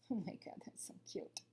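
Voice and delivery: breathy aside, barely voiced; whisper. Real, not acted. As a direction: whisper it just for the listener.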